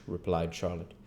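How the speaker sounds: ordinary delivery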